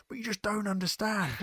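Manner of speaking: put-on high-pitched, frustrated kid protesting; child's rage. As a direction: wail it like a raging misunderstood child. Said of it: "that sort of rage".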